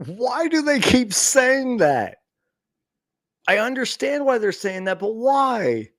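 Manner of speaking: high, slow disbelieving rant; exasperated. Real, not acted. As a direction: throw your hands up in disbelief. Ranting at the player's clichéd answer.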